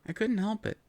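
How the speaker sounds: usual speaking voice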